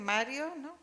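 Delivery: usual speaking voice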